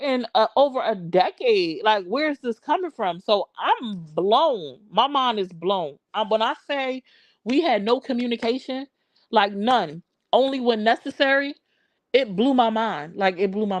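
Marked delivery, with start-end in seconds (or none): none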